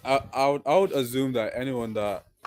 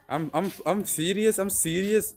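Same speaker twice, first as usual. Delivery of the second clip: high-pitched imitation of an Irish accent; attempted Irish lilt, pitched high; 9 semitones higher than usual. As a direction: mimic an Irish boy insisting in an interview. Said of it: "I'm not really good with the Irish accent".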